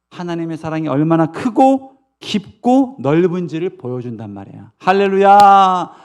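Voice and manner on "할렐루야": loud, drawn-out preacher's hallelujah; exultant hallelujah. Real, not acted. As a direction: call it out to the congregation, falling slowly.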